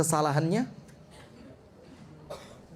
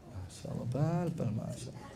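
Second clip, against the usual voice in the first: unvoiced, softer whispered exclamation; 4 semitones lower, 11 dB quieter than usual. Real, not acted. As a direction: whisper it off-mic in dismay. Reacting to the microphone dying. (berbisik kaget karena mikrofon mati)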